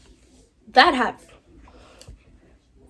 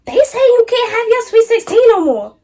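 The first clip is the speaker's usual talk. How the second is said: high, loud mom voice breaking bad news; mom's shrill news; 11 semitones higher, 7 dB louder than usual. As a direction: squeal it out like an upset mom. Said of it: "this is what she say".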